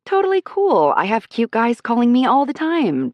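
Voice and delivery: high, bright put-on casual; fake casual. A narrator's reading. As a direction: act breezy and unbothered. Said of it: "I say in my "totally cool ..." tone".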